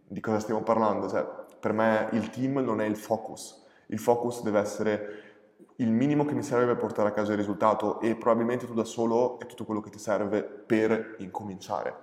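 ordinary talk

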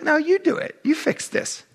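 a hint of Jesus handing the job back; Jesus telling them. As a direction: toss it back at them, a mild mock order. Jesus quoted telling disciples.

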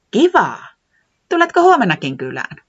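light up with joy; Säämies delighted. Acted. Säämies delighted, inviting Tiputi again, high and glad. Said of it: "säämies ilahtui".

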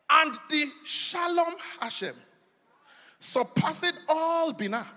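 slow, deliberate reading of Hebrew names; slow ceremonial reading; solemn scripture reading. Acted. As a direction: lay each word down like a liturgy. Reads verse in Hebrew terms.

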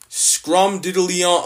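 loud, slow nonsense slang shouted out; loud hammed-up mockery of nonsense slang. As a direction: belt out the made-up slang slowly.

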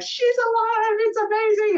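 high, elated cry of relief; joyful relief. Retold or imitated. Mother hearing her newborn cry, elated and high. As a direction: burst out in overjoyed disbelief.

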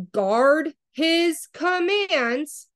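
"guard his commands" stretched very slowly, pitched high; emphatic. Real, not acted. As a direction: draw out each word of "guard his commands". Stressing a verse while reading.

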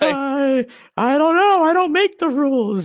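very high, loud ghost voice; wailing ghost. Pretend. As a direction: protest in a hammed-up spooky wail. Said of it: "Why do you talk like that, ghost?".

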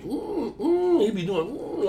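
high wordless whining hum; high "mm" whimpers, mock crying; imitated pet whimper. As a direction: mimic a pet crying for attention. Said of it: "like, crying".

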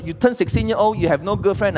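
normal talking voice

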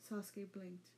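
usual speaking voice

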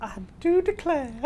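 high-pitched mock Southern belle; female Southern accent. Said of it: "there is a female Southern accent".

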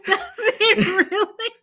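words squeezed out through real laughter, high and slow; real giggling question; laughing. Real, not acted. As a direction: laugh helplessly while asking. Still cracking up.

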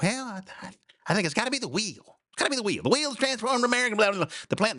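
mock falsetto lady pondering; high soft put-on woman's voice. Woman voiced in retold joke.

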